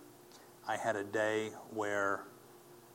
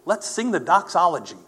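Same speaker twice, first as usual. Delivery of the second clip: loud call; 8 semitones higher, 13 dB louder than usual. Retold or imitated. Rallying the room to sing.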